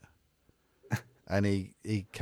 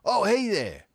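higher, lightly voiced friendly American hello; a breezy tourist's greeting; imitating the American couple saying hello at the bus stop; American tourists greeting; 12 semitones higher, slower than usual. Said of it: "and they went".

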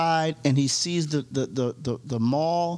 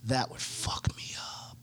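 whispered; 4 semitones lower, 14 dB quieter than usual. Real, not acted. Horrified at Tom Hanks idea.

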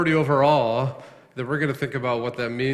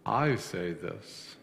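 quiet weighty quote; 6 semitones lower, 9 dB quieter, much slower than usual. Acted. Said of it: "he says".

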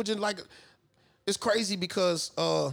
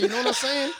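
put-on Mexican accent; 6 semitones higher than usual. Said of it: "I wanna know where this accent came from".

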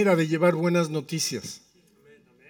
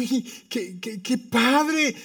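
high, slow exaggerated cheer; hammed-up enthusiasm; mock delight; 8 semitones higher, much slower than usual. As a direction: stretch it out in sarcastic wonder. Mock delight at numbers called.

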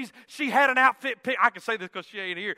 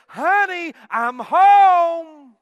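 high, loud, drawn-out sing-out; sweet angelic voice; 7 semitones higher, 13 dB louder, much slower than usual. Retold or imitated. Wife calls to husband on arriving home, mock angelic voice. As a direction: imitate an angelic wife calling out sweetly, stretching the last word. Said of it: "sweetest little angelic voice".

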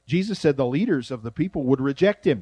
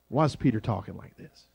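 ordinary talk, nothing marked

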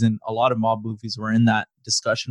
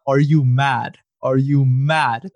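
mock UK rapper; slower than usual. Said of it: "That's my favorite".

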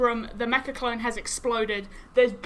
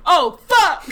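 louder, higher startled curse; shocked outburst; 6 semitones higher, 9 dB louder than usual. Retold or imitated. Game master voicing the guard realizing the clone outranks him, loud. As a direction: blurt it out in sudden alarm. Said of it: "And he's like".

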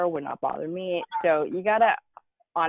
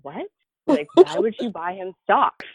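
retold disbelief; 5 semitones higher, 7 dB louder, slower than usual. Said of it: "I remember being like".

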